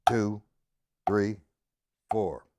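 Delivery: low, evenly spaced counting, one number per beat; slow quarter-note count. Shown as a demonstration. Drum teacher redoing line one, slow measured count. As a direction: concentrate and count slowly, keeping strict time. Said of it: "line one again in quarter notes".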